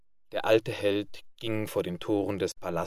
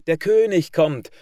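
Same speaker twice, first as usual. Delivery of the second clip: loud, higher-pitched announcement; calling out; 7 semitones higher, 9 dB louder than usual. A narrator's reading. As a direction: call out the arrival like a herald. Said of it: "rief ein prächtiger Vogel".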